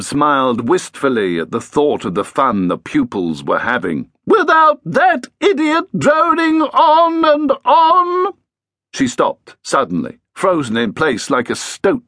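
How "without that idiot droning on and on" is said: much higher, louder moaning ghost voice; high, whining ghostly moan; moaning ghost Edith. Acted. Edith continuing her complaint.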